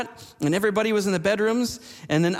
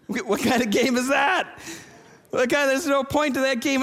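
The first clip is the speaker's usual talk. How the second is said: laughing; 7 semitones higher than usual. Real, not acted. Pastor laughing at kids' game.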